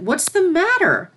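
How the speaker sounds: high, loud alarmed question; startled mentor calls out in alarm. Acted. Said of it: "asked the startled Princess Celestia".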